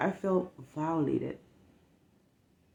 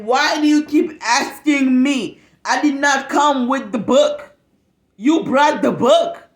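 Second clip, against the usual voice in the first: exasperated; 7 semitones higher, 11 dB louder than usual. Retold or imitated. Retold snappy reply to child.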